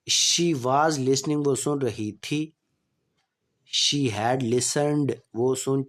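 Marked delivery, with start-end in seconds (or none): 0.1-1.4 slow, clear dictation pace; slow classroom example sentence; slow example read. Shown as a demonstration. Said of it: "teacher reading tense example". 3.9-5.1 slow, clear dictation pace; slow classroom example sentence; slow example read. Shown as a demonstration. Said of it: "teacher reading tense example".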